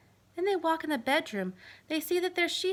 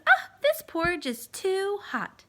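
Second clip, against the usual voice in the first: put-on slow, louder little-girl complaint; Goldilocks; 5 semitones higher, 5 dB louder than usual. Goldilocks tasting the too-hot porridge, slow complaint. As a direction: drag it out like a fussy girl.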